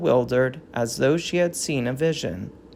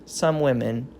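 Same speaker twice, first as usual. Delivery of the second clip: ordinary delivery, nothing marked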